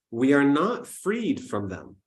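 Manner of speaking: usual speaking voice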